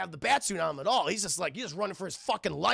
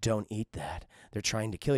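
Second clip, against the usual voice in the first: very low, ominous voice; a menacing inner voice giving warnings; low, sinister intoning; voices in head; 9 semitones lower than usual. Retold or imitated. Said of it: "the voices start coming in".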